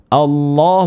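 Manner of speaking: "Allah" with a heavy lam, like "Alloh"; thick lam. Shown as a demonstration. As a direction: round the second vowel of "Allah" toward o. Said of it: "dibacanya dengan lam mufakhamah".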